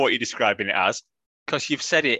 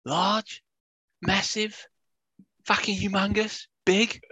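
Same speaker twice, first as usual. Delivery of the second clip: high mock pundit voice piling up words; Gage impression; 9 semitones higher, slower than usual. Co-host takes over the pundit impression, high and mocking. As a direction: caricature the rambling commentator. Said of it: "you'll have to be the Gage impressionist".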